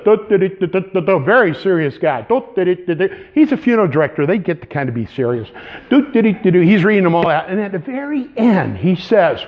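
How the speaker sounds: normal talking voice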